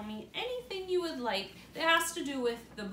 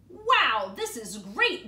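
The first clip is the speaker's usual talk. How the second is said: put-on thrilled boy; 2 semitones higher, 11 dB louder, much slower than usual. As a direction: burst out delighted. Boy thrilled by fallen tree.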